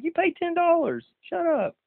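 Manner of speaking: high mocking retort; mock snap back at the complaining fans; snappy comeback. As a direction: brush them off with scorn. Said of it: "I'm like".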